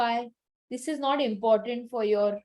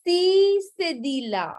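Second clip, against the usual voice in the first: emphatic announcement; 5 semitones higher, 4 dB louder than usual. Shown as a demonstration. Teacher announces the next accent.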